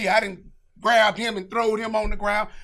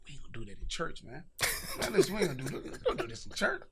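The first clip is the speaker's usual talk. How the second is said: hushed plea; 4 semitones lower, 13 dB quieter, faster than usual. Retold or imitated. Pleading with girlfriend in church.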